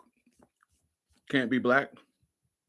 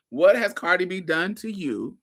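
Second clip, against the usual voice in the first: ordinary talk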